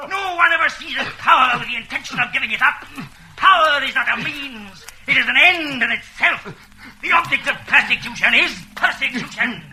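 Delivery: fervent rising rant about power; fanatical declaration. Acted. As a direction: preach power like a zealot. Interrogator's fanatical speech.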